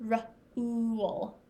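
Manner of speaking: "R-ool" with a long, stretched oo; oo sound in rule. Shown as a demonstration. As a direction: draw out the oo in rule. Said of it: "Rule follows that oo sound".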